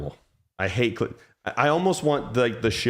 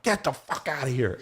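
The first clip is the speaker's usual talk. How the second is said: ordinary talk, nothing marked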